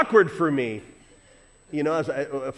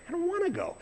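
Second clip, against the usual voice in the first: whiny reluctance; 5 dB quieter, faster than usual. Retold or imitated. Husband whining to wife.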